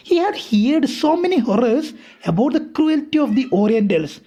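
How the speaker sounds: a careful read-aloud for students; slow story reading. A narrator's reading. Teacher reading the English text aloud to her class, slow and deliberate. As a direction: read each English phrase slowly and deliberately.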